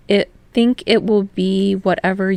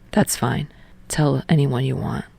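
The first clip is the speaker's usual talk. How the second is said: lower, softer and calm; easygoing, low and relaxed; Tsukishima voice; 5 semitones lower, 4 dB quieter than usual. Acted. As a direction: lower calm boyfriend answer.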